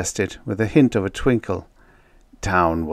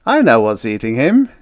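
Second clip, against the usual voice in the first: loud, higher, wise-guy tone; knowing; 4 semitones higher, 10 dB louder than usual. A narrator's reading. Joker diagnosing outpost man's trouble, knowing. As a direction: announce it loudly as if you know all. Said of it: "Town said wisely".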